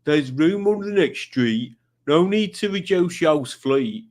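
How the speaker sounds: hammed-up imitation of a commenter's accent; Tommy's accent. Host rereading Tommy's message in Tommy's hammed-up accent. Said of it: "I should be doing this in his accent".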